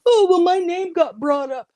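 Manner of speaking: high, loud, put-on whine; mocking whiny imitation. Mocking Laura's excuse in a high whiny voice. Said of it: "you wanna sit there and say".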